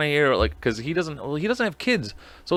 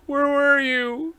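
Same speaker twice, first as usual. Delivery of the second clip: higher, slow, stretched-out mock cry; drawn-out mock wail; 12 semitones higher, much slower than usual. Host mock-mourning to the fake ad man, drawn-out wail. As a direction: wail it long like a hammed-up lament.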